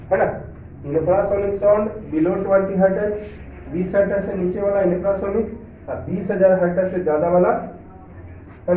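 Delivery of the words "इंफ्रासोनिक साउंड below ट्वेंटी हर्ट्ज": ordinary delivery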